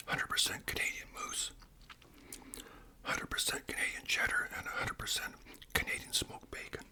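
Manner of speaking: ASMR whisper. Acted. Host whispering about Canadian food to viewers, ASMR whisper. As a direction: whisper each word like sharing a treat.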